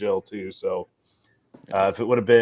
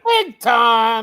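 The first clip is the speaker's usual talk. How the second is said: high, loud teasing shout; mock boast; 10 semitones higher, 7 dB louder than usual. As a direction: crow it out to tease. Mocking the big-time boast.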